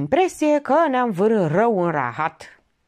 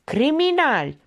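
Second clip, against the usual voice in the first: higher, drawn accusation; acted crowd shout; 8 semitones higher than usual. A narrator's reading. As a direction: cry out from the crowd. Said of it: "strigă un glas din mulțime". (strigăt acuzator, mai înalt)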